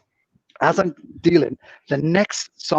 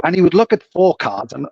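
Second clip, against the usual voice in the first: usual speaking voice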